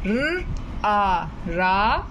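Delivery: र आ रा, drawn out very slowly; slow sounding out. Shown as a demonstration. Teacher drilling the student, very slow blend. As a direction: stretch each sound then blend. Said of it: "okay".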